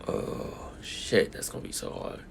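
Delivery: soft whispered groan of doubt. Real, not acted. Reviewer torn between both movies, whispering. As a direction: whisper it like a private struggle.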